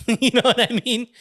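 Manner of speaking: high, laughing delivery; laughter bubbling through the words. Real, not acted. Laughing at wolf to pug.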